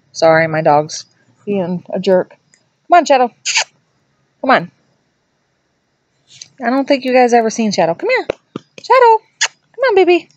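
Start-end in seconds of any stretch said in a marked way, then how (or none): 2.3-4.7 calling the dog. Real, not acted. 7.9-10.3 very high, sing-song dog-coaxing voice. Real, not acted. Calling her dog sweetly.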